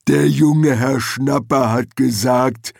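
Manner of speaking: slow, creaky troll voice; ponderous troll, words dragged out; creaking troll. A narrator's reading. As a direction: creak it out slowly like a lumbering troll. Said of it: "knarzte er".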